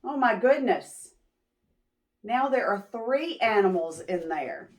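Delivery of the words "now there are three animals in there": ordinary delivery, nothing marked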